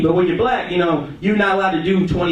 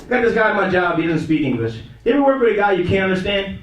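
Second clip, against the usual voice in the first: usual speaking voice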